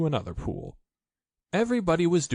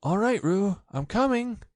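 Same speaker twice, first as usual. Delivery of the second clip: put-on higher boyish call out; Christopher Robin calling; 9 semitones higher than usual. A narrator's reading. Christopher Robin calling to Roo while running, higher boy's voice. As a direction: call out to reassure him. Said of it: "called Christopher Robin".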